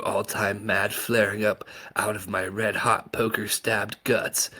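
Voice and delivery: ordinary delivery, nothing marked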